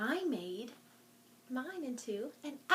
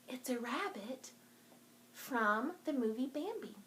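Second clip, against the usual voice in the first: normal talking voice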